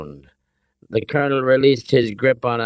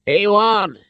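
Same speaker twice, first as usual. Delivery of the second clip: high, insistent cry; insist, voice pitched right up; yelled answer; 13 semitones higher, 4 dB louder than usual. A narrator's reading. Father answers amid the standoff, yelling.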